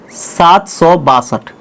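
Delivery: drawn out, slow, word by word; slow classroom dictation. Shown as a demonstration. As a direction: spell the number out slowly as you write it. Teacher dictates number while writing.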